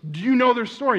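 high, loud, acted incredulous question; urgent questioning. Church friend phoning to question him, acted urgency. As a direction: quiz him urgently, pitch well up.

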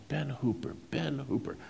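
trying to place a name, hushed; musing softly. Retold or imitated. Craddock recalling the name.

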